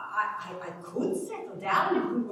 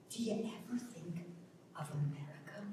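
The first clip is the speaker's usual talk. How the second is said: a dreamy near-whisper, lower and much softer; hushed; 4 semitones lower, 10 dB quieter than usual. Acted. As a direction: breathe the word America as a longing. Wistful question about America.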